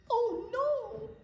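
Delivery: high squeaky gasp of alarm; a sudden frightened cry. Acted. Narrator voicing Kevin as the tree gives way, alarmed.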